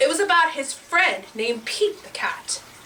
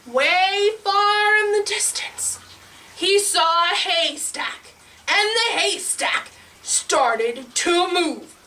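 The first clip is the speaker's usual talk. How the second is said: drawn-out, higher storytelling; slow suspense; 6 semitones higher, slower than usual. A narrator's reading. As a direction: stretch it out to build mystery. Suspense as haystack moves.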